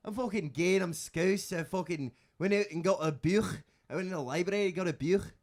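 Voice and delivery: hammed-up Liverpool lad bragging; scouse accent. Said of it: "I can do regional British accents".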